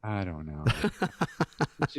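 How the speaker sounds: normal talking voice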